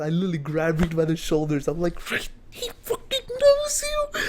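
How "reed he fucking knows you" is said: very high, slow squeal of disbelief; squealing excitement. Retold or imitated. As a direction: shriek it like a fan.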